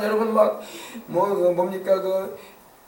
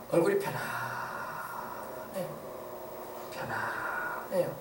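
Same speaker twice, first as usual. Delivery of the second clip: gentle calm; 6 semitones lower, 12 dB quieter, much slower than usual. Retold or imitated. Peaceful face of the departed.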